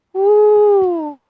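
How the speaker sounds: long, high, loud woo; excited woo. Real, not acted. Gardener thrilled at the sprouted roots, excited whoop. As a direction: let out a drawn-out whoop.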